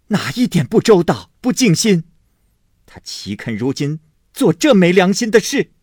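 loud, high scolding of her son; stern rebuke. A narrator's reading. Mother scolding her son for beating the maid, loud. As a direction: stop him with a sharp reproach. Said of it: "薛姨妈跑来进喝说".

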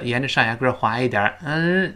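ordinary talk, nothing marked

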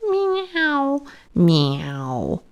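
high plaintive mew, then a falling one; cat meowing. A narrator's reading. Hungry cat Simpkin interrupting the singing mice, plaintive meows. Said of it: "interrupted Simpkin".

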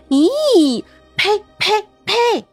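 high, disgusted yuck and spitting. Acted. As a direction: spit it out in disgust. Disgust at maggots in food.

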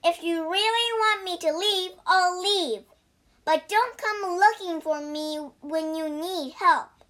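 high, heated outburst; angry. A narrator's reading. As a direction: storm at the master, hurt and indignant. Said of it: "Wukong became angry".

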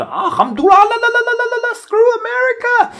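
very high, loud, laughing parody of a ranting man; loud, squeaky mock rant broken by laughter. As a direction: ham up a ranting voice off the news. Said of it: "然后他们说话就是".

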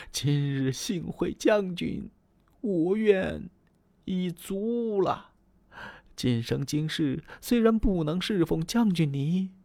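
Diao Chan weeping, pleading through tears; tearful plea. Acted. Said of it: "眼泪就下来了".

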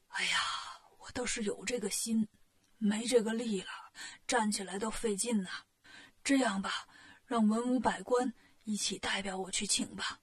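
soft, low voice with little strength; weak, ailing king. A narrator's reading. Sick king delegates the invitation to his officials, weak and weary. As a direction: sigh it out feebly, pausing between phrases.

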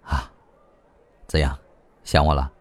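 low, soft flirtatious question; lightly voiced man, low and playful; amused teasing. A narrator's reading. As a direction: tease softly after a sigh. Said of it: "笑着问".

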